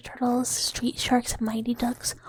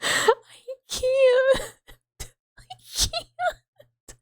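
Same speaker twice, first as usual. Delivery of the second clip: high, breaking laugh between words; cracking up, can barely speak; helpless laughter; 12 semitones higher, much slower than usual. Real, not acted. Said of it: "helpless laughter".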